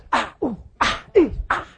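pitch-rising ouch sounds; wincing ah, ooh, ah, ee, ah; mock pained yelps. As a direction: yelp as each hair is plucked. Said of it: "and I'm going".

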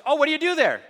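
loud, high-pitched mock hairdresser; gum-chewing hairdresser. Hairdresser quizzing her client mid-haircut, loud gum-chewing caricature. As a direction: exaggerate a brash, chewing-mouthed chatterer. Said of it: "chewing her gum and cutting my hair".

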